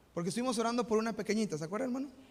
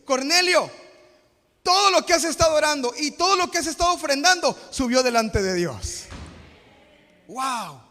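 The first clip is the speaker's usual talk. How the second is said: loud and high, driving the point home; full-force preaching; 5 semitones higher, 9 dB louder than usual. Acted. Pastor preaching on prayer to the congregation, loud and high.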